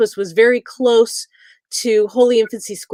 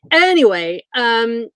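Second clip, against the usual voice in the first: ordinary delivery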